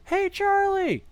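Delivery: high squeaky call across the bar; high-pitched mock greeting. Gals calling out across bar.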